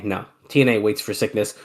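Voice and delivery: normal talking voice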